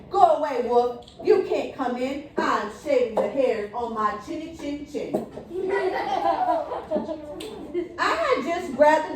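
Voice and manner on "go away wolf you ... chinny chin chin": ordinary delivery, nothing marked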